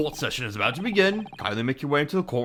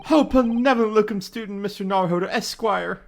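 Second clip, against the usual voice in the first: high, louder overwrought thanks; gushing gratitude; 7 semitones higher, 4 dB louder than usual. Acted. Grateful defendant hails his lawyer, high and loud. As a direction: praise him like a saviour.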